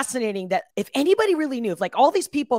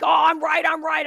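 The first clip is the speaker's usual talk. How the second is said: mock self-righteous; 7 semitones higher, 5 dB louder than usual. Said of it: "even if you think".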